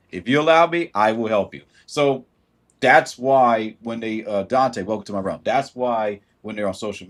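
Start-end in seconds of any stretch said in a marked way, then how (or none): none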